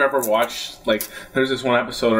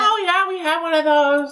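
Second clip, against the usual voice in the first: very high-pitched put-on clerk voice; clueless cinema clerk. Clerk at the cup stand answers a customer, high mock-helpful voice. Voicing cinema staff who finally understand.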